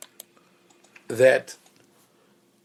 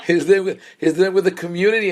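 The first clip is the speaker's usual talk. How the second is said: higher and louder, rushed x1.7; excited, still laughing; 5 semitones higher, 5 dB louder, much faster than usual. Real, not acted. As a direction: push on through the giggle. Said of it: "laughing at own joke".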